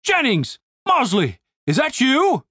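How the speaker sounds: booming demand breaking into appalled recognition; booming outrage. A narrator's reading. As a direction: call down in scandalised authority. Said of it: "The booming voice sounded familiar".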